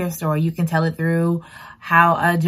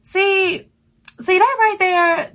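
high, thrilled see that right there; light up at the good part; excited pointing out; 13 semitones higher, slower than usual. Real, not acted. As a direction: vlogger delighted by a line from the book. Excited about story.

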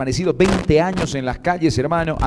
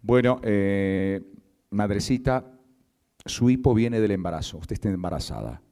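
grave doctor; 9 semitones lower, 7 dB quieter than usual. Acted. Doctor breaking news slowly.

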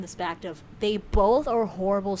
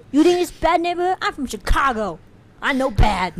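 higher, louder mock Chicago accent; bad Chicago-Russian accent; 7 semitones higher, 7 dB louder than usual. Mocking own failed attempt, loud Chicago-tinged accent. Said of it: "That was Chicago".

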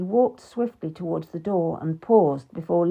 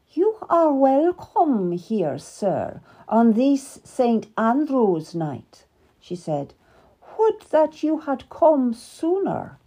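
higher, slow, formal queen; cold royal welcome; 5 semitones higher, slower than usual. Acted. Queen to King Henry at dinner, slow and cool. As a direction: greet him with chilly courtesy.

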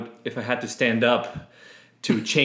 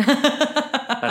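laughing; 14 semitones higher than usual. Real, not acted. Laughing, high pitched aside.

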